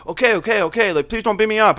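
put-on high placating plea; mock pleading. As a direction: mimic him backing down in a high voice. Acting out the man pleading again.

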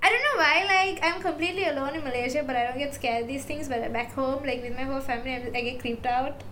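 normal talking voice

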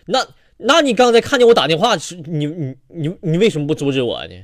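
mock-furious stammer at the man on the plane; angry. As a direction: blow up at the passenger, tripping over words. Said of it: "我当时就火了".

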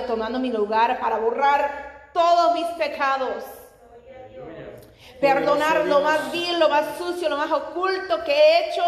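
loud, impassioned sermon, climbing in pitch; full-force preaching. Real, not acted. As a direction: build to a high, loud preaching climax. (predicación a plena voz, con fervor creciente)

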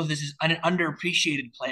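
ordinary talk, nothing marked

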